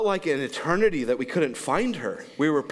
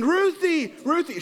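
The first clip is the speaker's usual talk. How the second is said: high calling of a name; mother searching the street, calling; 11 semitones higher than usual. Retold or imitated. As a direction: call her name down the street. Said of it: "running down the street screaming".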